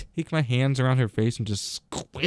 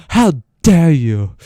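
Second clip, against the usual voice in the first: slow, louder mock of Greta; 9 dB louder, much slower than usual. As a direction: draw out how dare you with mock outrage. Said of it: "It's a stupid voice".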